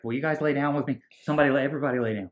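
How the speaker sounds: fast, high, loud mock panic; mock freak-out. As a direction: rattle out a panicked plea, imitating him. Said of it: "Mark is freaking out".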